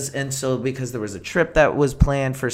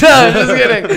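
laughing it off, higher and louder; 11 semitones higher, 14 dB louder than usual. Real, not acted. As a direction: burst out laughing at your own joke. Laughs at own Ghostbusters joke.

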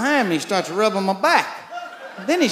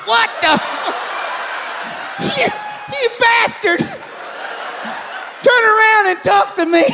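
outraged yell; 11 semitones higher than usual. Acted. Yelling at the doctor.

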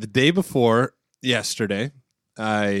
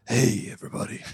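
mock radio-host greeting, softer than usual talk; 4 dB quieter than usual. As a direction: put on a radio voice to greet listeners. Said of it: "new radio voice".

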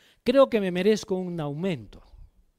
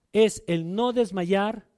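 slow emphatic preaching; much slower than usual. Real, not acted. Preacher stressing key point.

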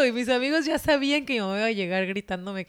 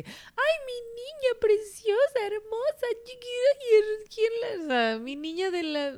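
doting mother voice; 13 semitones higher than usual. Retold or imitated. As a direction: coo like a mother smothering her little girl. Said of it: "pero así de esos de".

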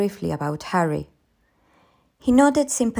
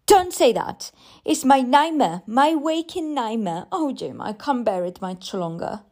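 desperate, worn-down pleading; distressed cry; 5 semitones higher than usual. A narrator's reading. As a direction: burst out, then fade into despair. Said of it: "I cried".

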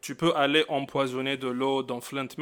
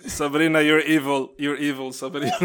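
laughing; 8 dB louder than usual. Real, not acted. Teasing friend while laughing.

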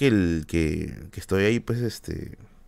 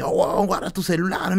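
retold yell; 9 semitones higher than usual. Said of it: "y el tío me grita".

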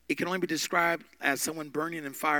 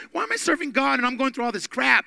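mock angry; 10 semitones higher, 8 dB louder than usual. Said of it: "he was angry".

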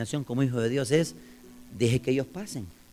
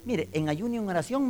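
inmate's earnest claim; 7 semitones higher than usual. Acted. Inmate answering the visiting preacher, earnest raised voice. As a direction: voice the inmate stating it hopefully. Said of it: "me dijo él, y yo me adentro".

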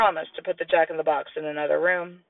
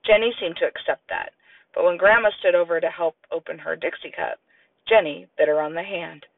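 ordinary delivery, nothing marked

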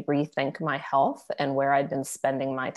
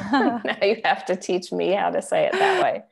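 laughing; 6 semitones higher, 6 dB louder than usual. Real, not acted. Laughing after compliment on name.

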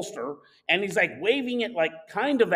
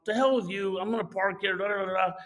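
plain reading voice

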